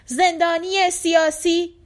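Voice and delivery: loud, high, drawn-out cry; shouted slogan. A narrator's reading. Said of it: "فریاد کشید".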